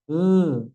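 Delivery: "E" as a short voiced dh with the tongue out; sukun zal sound. Shown as a demonstration. Teacher demonstrating zal with sukun. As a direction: show the bare zal sound. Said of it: "Bunyi sukun zalnya".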